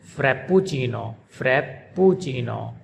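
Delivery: frappuccino the Italian way, held double p and a clear oo: frap-poo-chee-no; Italian frappuccino, lengthen the pp and say the u as oo; Italian pronunciation. Shown as a demonstration. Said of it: "they pronounce it".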